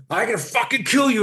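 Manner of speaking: high, loud threatening yell; a role-played road rager turning on the trainee; sudden threat. Shown as a demonstration. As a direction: snap into a sudden attacker's threat. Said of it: "all of a sudden I go".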